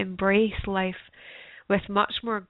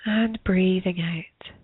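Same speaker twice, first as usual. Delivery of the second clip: guided meditation. Acted. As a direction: ease them gently into stillness.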